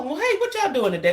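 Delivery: a hint of a prim presenter; polished professional greeting. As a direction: imitate her smooth, proper on-air hello. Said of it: "you are so professional".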